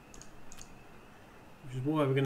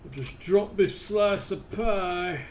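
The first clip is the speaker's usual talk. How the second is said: mock solemn; 6 semitones higher, 8 dB louder, slower than usual. Real, not acted. Mock solemn drop of pie.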